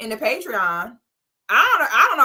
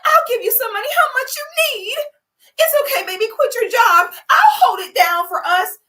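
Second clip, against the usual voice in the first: much higher, gushing, loud comfort; mock doting girlfriend; 12 semitones higher, 4 dB louder than usual. Girlfriend coddling her jobless man, high and gushing. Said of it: "Your solution should never be".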